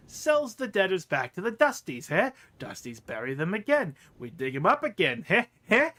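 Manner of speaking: gleeful about digging up bodies; sly merchant. Acted. Quint gleefully describes reselling corpses, snorting laugh.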